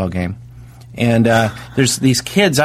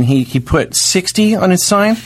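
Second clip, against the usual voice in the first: normal talking voice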